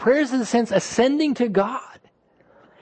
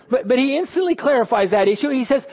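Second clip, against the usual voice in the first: ordinary talk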